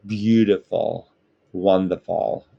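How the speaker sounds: lightly imitated US president, words drawn out; half-successful presidential impression, stretched words; forty-fifth US president. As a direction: have a go at a slow presidential "beautiful". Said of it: "How the forty-fifth president of the United States of America would say it".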